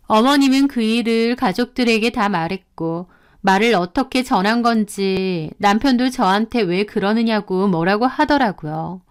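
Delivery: plain reading voice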